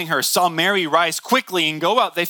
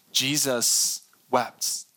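solemn; 4 semitones lower, 4 dB quieter, much slower than usual. Acted. Slow solemn scripture verse.

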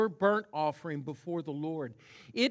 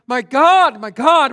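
very loud, high cry of despair; preacher voicing Jesus crying out on the cross; loud anguished cry; 9 semitones higher, 18 dB louder than usual. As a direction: shout it up to heaven. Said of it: "he cries out".

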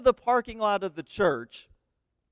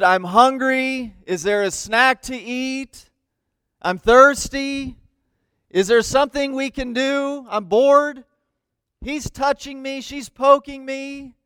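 put-on higher, slow drawn-out kid complaints; whining kids; 5 semitones higher, 5 dB louder, slower than usual. Kids pestering parents on a road trip, whining. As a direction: whine like bored children in the back seat.

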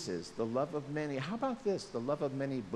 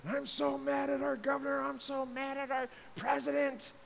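higher, mock-angry complaint; mock fury; 10 semitones higher than usual. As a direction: whine it like a grumbling voter. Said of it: "The fury is".

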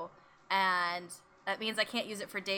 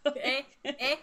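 mock Canadian "eh", pitched up; 4 semitones higher than usual. Echoing the co-host's joke, mock Canadian. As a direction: toss out a playful Canadian eh. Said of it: "That's, that's Canadian?".

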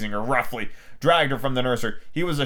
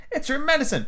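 higher feminine character voice; reproachful wife; 11 semitones higher than usual. Acted. Wife discovers husband's trick, reproachful. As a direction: chide him in a raised pitch.

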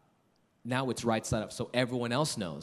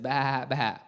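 drawn-out sheep-like bah; imitated sheep bleat; 2 semitones higher, 4 dB louder than usual. Father playing animal game with son, imitated bleat. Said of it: "make a series of noises".

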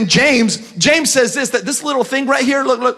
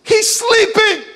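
high-pitched, drawn-out disbelief; much higher, as if astonished; incredulous exclamation; 10 semitones higher, slower than usual. Acted. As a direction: squeal it in mock disbelief. Incredulous that Jesus is napping.

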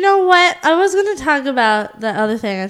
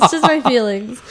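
normal talking voice

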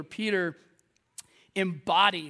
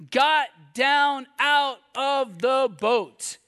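deliberate word-by-word reading; 4 semitones higher, 5 dB louder, much slower than usual. A narrator's reading. Dwells on favourite verse, word by word.

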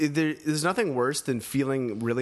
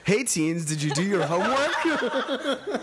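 put-on high silly teen voice; 8 semitones higher, slower than usual. Mimics the show's high intro voice.

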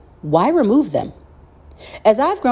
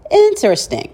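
higher-pitched, pointed mock musing; skeptical reaction; 5 semitones higher, slower than usual. Host voicing viewers' reaction to what YouTube allows, mock-intrigued. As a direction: act out a pointed "hmm" reaction. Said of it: "and you're like".

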